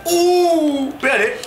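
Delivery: rising excited shouts; breakneck goal commentary. Real, not acted. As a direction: yell it at the goal.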